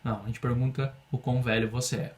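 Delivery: ordinary talk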